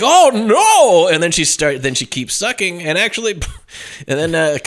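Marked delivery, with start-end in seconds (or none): 0.0-1.1 high, loud mock-horror wail; horrified cry. Man realizing it is the wrong woman, horrified cry. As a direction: cry out in exaggerated dismay. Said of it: "And then he's like".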